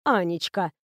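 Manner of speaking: tenderly. A narrator's reading. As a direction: stop the child kindly. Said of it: "ласково остановила".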